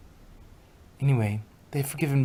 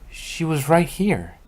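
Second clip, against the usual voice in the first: a loud, country-style pony voice; Applejack; 2 semitones higher, 7 dB louder than usual. Acted. Applejack telling Twilight where Trixie is, loud and hearty. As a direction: call it out like a farm pony, hearty.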